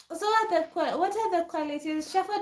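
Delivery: ordinary talk, nothing marked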